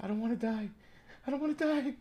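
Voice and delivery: high put-on whimpering plea, imitating a movie hero; mock dying plea. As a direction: squeak it out like a scared kid who doesn't want to die. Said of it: "He was like".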